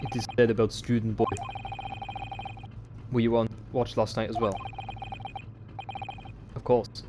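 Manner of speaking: put-on curt Russian accent; clipped Russian sailor. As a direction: answer shortly like a Russian sailor. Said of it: "I like to do Russian accents".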